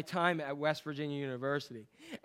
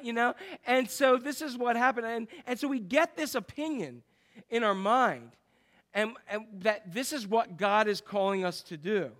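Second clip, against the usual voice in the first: ordinary talk, nothing marked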